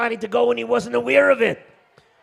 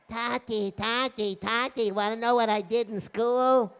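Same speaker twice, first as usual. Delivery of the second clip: put-on lightly pitched-up child voice; child calling father; 3 semitones higher than usual. Child calling to father after school, sing-out calling. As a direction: call out like an eager kid. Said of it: "his kinderlach are calling".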